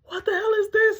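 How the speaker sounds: high, loud, crying-out what the hell; half-wailing what the hell is this; shocked outburst. Retold or imitated. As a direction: cry it out in shocked disbelief. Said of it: "But I'm like".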